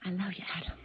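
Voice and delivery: soft intimate whisper. Acted. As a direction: barely breathe the words.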